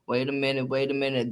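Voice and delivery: ordinary delivery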